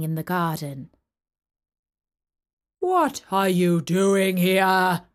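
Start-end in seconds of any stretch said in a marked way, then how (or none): 2.8-5.1 loud, slow gruff giant. Acted. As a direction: bark it like an angry giant. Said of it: "he cried in a very gruff voice".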